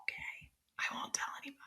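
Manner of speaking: hushed, conspiratorial question; playful whisper. Pretend. Host promising guest she won't tell, whispering. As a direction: whisper as if keeping a secret. Jokingly keeps guest's answer secret.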